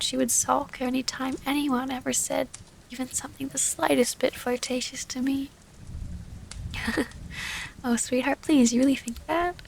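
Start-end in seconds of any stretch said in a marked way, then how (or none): 5.9-7.8 a quiet breathy laugh, amused; soft fond laugh. Acted. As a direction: laugh softly at their jealousy. Laughs at lover's jealousy.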